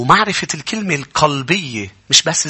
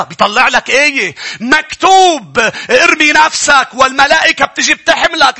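high, loud fiery sermon voice; preaching at full force; 7 dB louder than usual. Acted. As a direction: thunder the point at the congregation. (وعظ بحماس وبصوت عالٍ)

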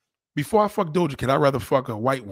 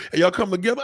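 ordinary talk, nothing marked

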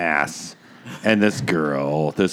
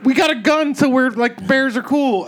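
high, louder mock bravado; cocky mock tourists; 3 dB louder than usual. Joker voicing overconfident fishermen with a gun, high mock bravado. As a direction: put on a high, carefree voice.

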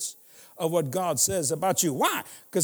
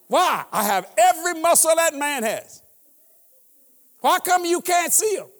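preaching full force; 8 semitones higher, 7 dB louder than usual. Real, not acted. Preacher joking to the crowd.

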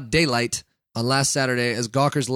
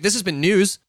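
news anchor sign-off; 4 semitones higher than usual. Shown as a demonstration. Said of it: "Can you tell I'm a professional?".

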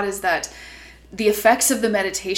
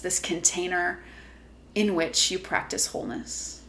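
guided meditation; 5 dB quieter than usual. Acted. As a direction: gently guide them inward.

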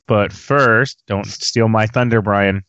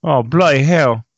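mock English accent; 4 semitones higher than usual. Shown as a demonstration. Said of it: "use our messed up English accents".

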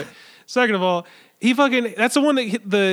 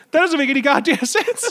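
friend's baffled reply; 8 semitones higher than usual. Retold or imitated. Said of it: "he was just like".

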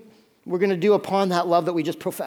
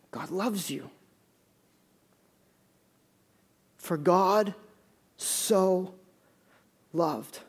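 hushed, solemn; 5 dB quieter, much slower than usual. Real, not acted. Preacher telling brothers and sisters God loves them, hushed. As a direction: drop your voice and let each phrase settle. Preacher lets key verse sink in.

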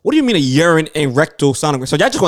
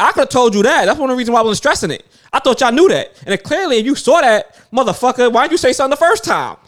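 higher and louder, rising exasperation; mock indignant rant; 9 semitones higher, 4 dB louder than usual. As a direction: tear into the nurse, hammed-up fed-up. Retold rant at the nurse.

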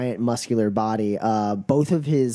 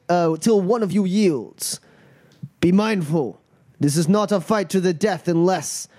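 raised, emphatic declamation of rules; ceremonial fight master; 6 semitones higher than usual. Acted. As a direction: lay down the law to the fighters. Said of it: "and he's like".

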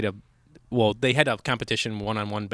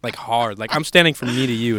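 ordinary talk, nothing marked

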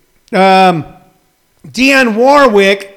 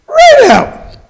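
squeaky comic flourish; 6 dB louder than usual. Pretend.

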